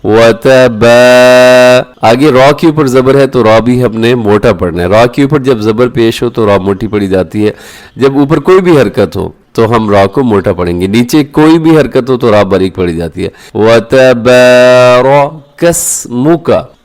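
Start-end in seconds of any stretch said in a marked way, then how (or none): 0.1-2.1 वतबा- with बा held very long. Shown as a demonstration. Teacher lengthening the बा for students. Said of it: "बा को मैंने क्यों लंबा किया". 13.6-16.8 slow melodic recitation with a clear मु. Shown as a demonstration. Teacher reciting tabarakasmuka correctly for students. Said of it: "कसमोका पढ़ना दुरुस्त नहीं है".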